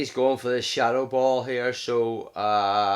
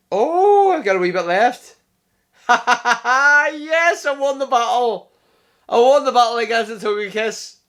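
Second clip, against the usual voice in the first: loud, high, laughing cheer; thrilled victory shout; 11 semitones higher, 11 dB louder than usual. Real, not acted. Player cheering his battle win over Togekiss. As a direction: celebrate the narrow win out loud.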